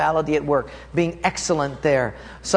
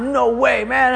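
higher, louder cocky shout back; defiant shout; 8 semitones higher, 4 dB louder, slower than usual. Retold or imitated. Runner shouts back at his friend, defiant and loud. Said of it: "I said".

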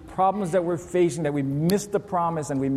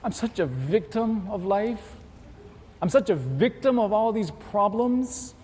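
slow, high, self-pitying moan; mock victim whine; 6 semitones higher than usual. Believer playing the victim of life, slow mock whine. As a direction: drag it out like a martyr. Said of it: "You know what we're saying?".

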